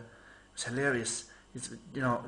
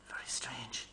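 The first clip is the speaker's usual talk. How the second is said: whisper; 3 semitones lower, 11 dB quieter, slower than usual. Real, not acted.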